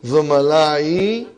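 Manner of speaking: Zumalai stretched slowly, rising at the end; slow drilled word. Shown as a demonstration. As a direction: drag out Zumalai with a questioning rise. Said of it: "We are talking about third person".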